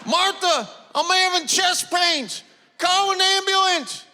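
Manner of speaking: high, strained plea to his wife; pleading husband. Acted. Husband begging wife during heart attack.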